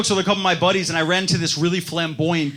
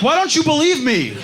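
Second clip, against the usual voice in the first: pleading; 9 semitones higher than usual. Retold or imitated. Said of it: "I'm like".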